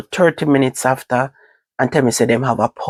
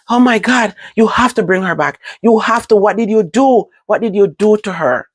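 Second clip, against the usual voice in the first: scream bawl; 7 semitones higher than usual. Retold or imitated. Begging the hospital to bring her back, high pleading. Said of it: "I me start scream bawl".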